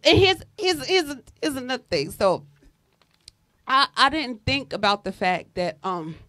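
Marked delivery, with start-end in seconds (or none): none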